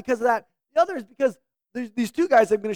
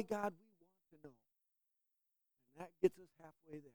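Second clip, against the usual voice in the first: soft, earnest prayer voice, mostly quieter; 3 semitones lower, 4 dB quieter than usual. Acted. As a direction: pray gently to God before the sermon. Leads an opening prayer.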